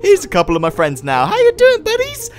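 high, cheery hello to NPCs; sing-song friendly hello; chirpy high greeting. Pretend. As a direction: greet them like a kids' host.

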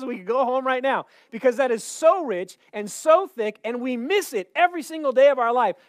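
loud, high, impassioned sermon delivery; full-force preaching. Real, not acted. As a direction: preach it loud, driving each phrase to the back row.

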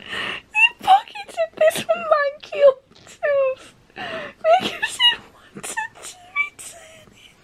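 very high, slow, tearful laughter; barely able to speak for laughing; helpless laugh-cry. Real, not acted. Reader laughing about the pocketed tooth, high and tearful.

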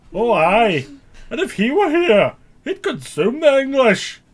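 put-on atrocious Scottish accent, high and very loud; Mel Gibson. Mocking a film star's Braveheart speech, terrible Scottish accent. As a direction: bellow a slow, overblown fake Scottish war cry. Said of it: "Scottish accent is literally the most atrocious".

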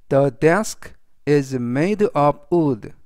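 very slow, deliberate example sentence; slow model reading. Shown as a demonstration. Teacher reading the example aloud to students, slow and clear. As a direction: spell each word out for learners.

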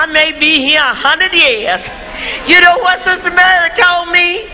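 ordinary delivery

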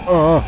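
loud high startled cry; startled yelp. Real, not acted. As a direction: jump at a sudden scare. Jump scare.

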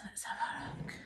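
secret little whisper. Acted. Hushed reveal to toddler.

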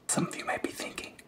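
normal talking voice

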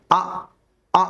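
short "আ" held inside the mouth; vowel kept inside mouth. Shown as a demonstration. Teacher showing learners a short, inward "আ". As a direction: barely let the "আ" out. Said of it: "আটা মুখের ভিতরে থাকবে".

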